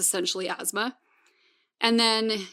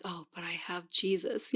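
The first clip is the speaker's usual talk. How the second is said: calm relief; 3 semitones lower, 9 dB quieter than usual. Retold or imitated. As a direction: quietly soothe yourself to sleep. Calming herself when panicky.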